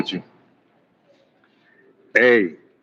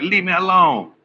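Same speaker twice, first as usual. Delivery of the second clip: mock annoyed plea; 7 semitones higher than usual. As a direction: drag it out like a fed-up friend. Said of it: "I used to be like".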